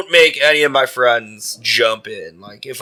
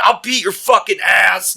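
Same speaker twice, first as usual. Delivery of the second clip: higher-pitched, drawn-out mock threat; imitated angry threat; 8 semitones higher than usual. Furious woman threatening a heckler, mock-angry imitation. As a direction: threaten him slowly, imitating her.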